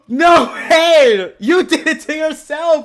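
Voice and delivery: high, shouted self-defence; loud protest. Real, not acted. Streamer arguing about a clip, high loud protest.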